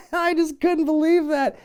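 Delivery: high, helpless laughing speech. Real, not acted. Host cracking up at an absurd segment, laughing. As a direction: talk through real laughter.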